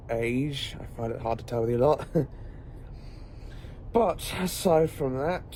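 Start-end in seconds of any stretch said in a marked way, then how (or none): 2.1-3.9 quiet airy chuckle; soft breathy laugh. Acted. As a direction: let a little laugh slip out. Amused aside about age.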